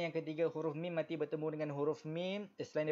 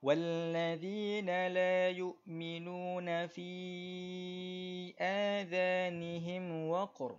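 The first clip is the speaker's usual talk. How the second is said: slow, higher melodic tajwid chant; Quran recitation; 4 semitones higher, slower than usual. Shown as a demonstration. Teacher reciting verse for the class, slow melodic tajwid. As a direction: recite for students to follow.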